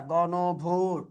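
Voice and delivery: careful citation of "গণভোট", both o sounds rounded; pronunciation demo. Shown as a demonstration. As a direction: model "গণভোট" as gono-bhot. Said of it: "গণভোট শব্দটির উচ্চারণ".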